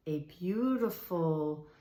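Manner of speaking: normal talking voice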